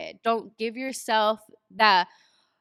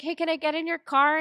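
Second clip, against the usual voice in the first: upbeat call; 8 semitones higher, 4 dB louder than usual. Retold or imitated. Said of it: "I was like".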